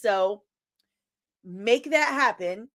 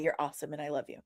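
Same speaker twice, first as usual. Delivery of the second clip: ordinary talk, nothing marked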